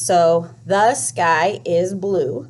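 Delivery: slow, each word set apart for counting; counting words slowly. Shown as a demonstration. Teacher models counting the words in a sentence, slow and deliberate. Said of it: "we count the le-, the words".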